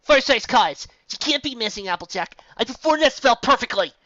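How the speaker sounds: loud, high frantic shout; panicked yell. A narrator's reading. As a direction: yell back while running in panic. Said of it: "Twilight yelled back into panic".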